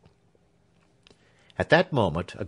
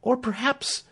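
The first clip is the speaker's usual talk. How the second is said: high, hopeful rising plea; pleading Clemency; 14 semitones higher than usual. A narrator's reading. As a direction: reach out with hope.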